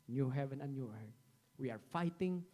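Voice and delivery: ordinary talk, nothing marked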